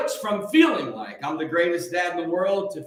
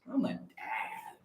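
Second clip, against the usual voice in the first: hushed whisper, slow and secretive; 12 dB quieter, slower than usual. Pretend. Confiding to livestream viewers he's a dad, whispered. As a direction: breathe it like a secret.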